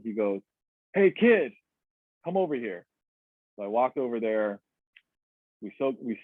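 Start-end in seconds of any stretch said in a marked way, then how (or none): 0.9-2.8 imitated New York accent, higher-pitched call; thick New York accent. Older man calling a kid from across the street, thick New York accent. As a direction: call out like an old New Yorker. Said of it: "in his thick New York accent".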